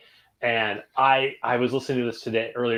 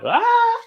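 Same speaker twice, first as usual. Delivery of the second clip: sudden high loud vocal yelp; high warmup yelp; 8 dB louder than usual. Retold or imitated. Singer re-enacting warmup for the podcast hosts: high loud yelp. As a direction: belt a squeaky high note. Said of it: "literally going".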